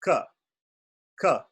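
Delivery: "k" as a short unaspirated click; bare K sound. Shown as a demonstration. Teacher isolating the consonant K. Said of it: "That is".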